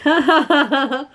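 high mock laugh, "ha ha ha"; put-on high teasing cackle; imitated laughing. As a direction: mimic someone laughing at you. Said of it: "he's laughing at me".

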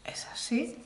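breathy whispered aside. Real, not acted. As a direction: drop to a secret whisper. Confiding aside to viewers.

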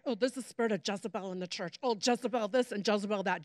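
low, soft, fast mocking mutter; lightly mocking church chatter; mock churchy gossip. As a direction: mimic the pious gossips dismissively.

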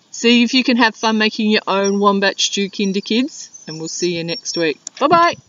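usual speaking voice

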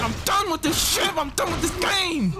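furious high yelling at the game; raging gamer. Real, not acted. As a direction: rage and shout at the screen.

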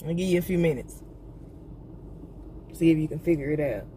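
ordinary talk, nothing marked

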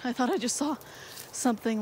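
ordinary delivery

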